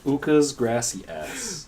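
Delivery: slow, laughing butchered thanks; bad Spanish. Repeating the coworkers' joke, in a bad gringo accent. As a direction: drawl the mangled thanks. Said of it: "worst way possible".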